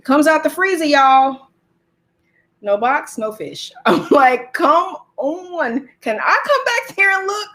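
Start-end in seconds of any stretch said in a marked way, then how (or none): 4.5-7.5 incredulous, higher-pitched appeal; drawn-out exasperated retort; exasperated pleading. Retold or imitated. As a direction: plead with the clerk in disbelief. Acted pleading with the clerk.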